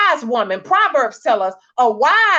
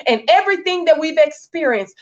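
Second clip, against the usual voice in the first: normal talking voice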